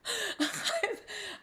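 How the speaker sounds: high giggling laugh; giddy high laugh. Real, not acted. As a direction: burst into a delighted laugh. Laughing at her own shock.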